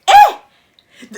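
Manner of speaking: loud, high, drawn-out laughing yelp; a sudden shocked shout that breaks into a laugh; startled yelp while retelling the confrontation; startled cry. Real, not acted. Shocked as he comes forehead to forehead.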